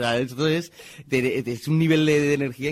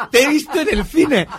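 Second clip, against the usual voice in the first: incredulous repeat; 13 semitones higher, 11 dB louder than usual. Retold or imitated. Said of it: "Resines me dice".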